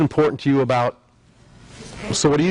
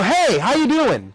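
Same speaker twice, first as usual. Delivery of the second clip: a higher, slow, over-bright "Hey, how you doing?"; mismatched upbeat greeting; 8 semitones higher, slower than usual. Shown as a demonstration. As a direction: greet them too cheerfully, out of step with their tone. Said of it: "You've mismatched them".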